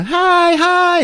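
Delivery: very high mock greeting; cheery skater girl. Girl greeting people as she skates, mock cheer. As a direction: chirp it high, imitating a happy girl.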